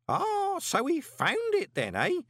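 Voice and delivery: put-on very high, gleeful old voice; triumphant. A narrator's reading. Groat gloating to Moist, triumphantly. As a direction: crow it in triumph. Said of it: "said Groat triumphantly".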